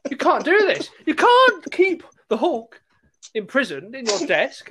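high, climbing protest of disbelief; rising indignation, pitching up at injustice; outraged pupil. Retold or imitated. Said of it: "I'm like".

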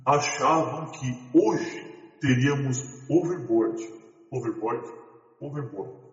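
slow filtered storytelling voice; storyteller voice changer. Pretend. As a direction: read it like a slowed story narrator. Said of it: "contador de histórias".

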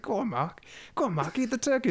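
high-pitched mock coaxing; high, insistent urging, acted out; goading. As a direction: imitate a pushy friend, voice pitched right up. Acting out character goading Mark.

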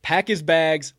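loud, high exasperated yell; fed-up shout. Real, not acted. Host fed up with the coach, loud high yell. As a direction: throw him out, fed up.

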